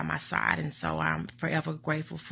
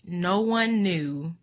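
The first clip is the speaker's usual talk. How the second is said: ordinary talk, nothing marked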